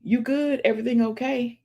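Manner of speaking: light high check-in voice, mildly imitated; gently voiced concerned friend; caring friend checking in. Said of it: "be like".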